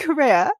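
"Career" pitched high with a hard r on the end; mock her "Career" back at her; mimicking "Career". Boyfriend mimicking her accent, mock r-heavy. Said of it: "he'd go".

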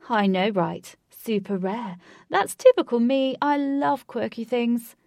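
loud posh gushing; posh accent. A narrator's reading. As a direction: gush about yourself in a posh accent. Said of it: "He said in a posh accent".

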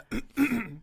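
loud rough throat clear. Real, not acted. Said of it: "coughing over here".

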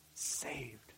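a single whispered word; whispered to the congregation. Real, not acted. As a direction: breathe it out softly. Hushed climax of sermon point.

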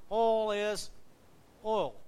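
"oil" said loud as one syllable, like "all"; "oil" with no "oy" glide, as said down south; southern "oil". Shown as a demonstration. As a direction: declare "oil" proudly the Southern way, rhyming with "all". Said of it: "'Cause that's our accent".

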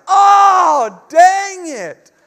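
high, loud comic outburst; mock frustrated shout. Dad hammed-up groan at daughter's song reminder, loud. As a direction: blurt it out in playful exasperation.

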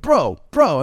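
mock-loud attempt to cut in; super loud. Friend and customer both super loud, imitated interjection. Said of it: "they're both, like, super loud".

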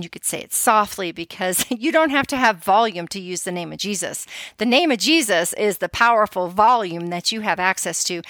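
usual speaking voice

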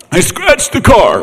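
high, slow mock wail; tearful confession. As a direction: draw out a daughter's crying confession. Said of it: "She called me crying".

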